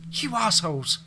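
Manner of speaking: high shrill angry shout; angry scream. Acted. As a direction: yell at them, furious. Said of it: "she screamed at the splashing revelers".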